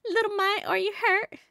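high, fussing Southern mama; Southern accent. Acted. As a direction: fuss over the babies in a high Southern accent. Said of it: "trying my best to talk in a southern accent".

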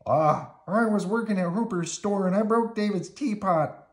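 high, loud, fretful puppet voice; upset, high-voiced explanation; Bert. A narrator's reading. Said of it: "Bert told him".

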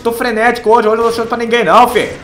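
hyped. Real, not acted. Hyped gamer boasting loudly.

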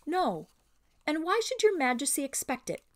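high, projected gallant mouse; proud, carrying voice. A narrator's reading. Mouse answers the queen for all to hear, proud and high. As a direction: answer so the whole company hears, pitched high. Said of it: "in a voice that most people heard".

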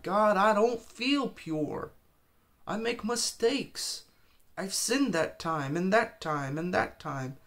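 high, loud and slow, crying out. Retold or imitated. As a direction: retell your tearful plea to God. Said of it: "And I'm crying".

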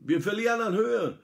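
alarmed interruption; urgent alarm. A narrator's reading. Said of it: "unterbricht ihn der Steuermann".

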